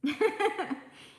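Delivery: high, loud laugh; burst of laughter. Real, not acted. Host laughing at her own empty-nest story, loud high laugh.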